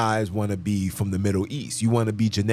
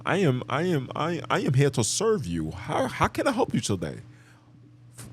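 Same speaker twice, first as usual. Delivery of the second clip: higher, sing-song customer-service parody; imitating a scripted operator, pitch swooping up and down; mock call-center voice; 4 semitones higher than usual. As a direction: recite it like an overly proper phone agent. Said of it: "If you start talking like".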